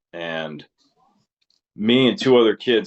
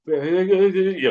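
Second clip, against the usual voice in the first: quivery voice; 11 semitones higher than usual. Shown as a demonstration.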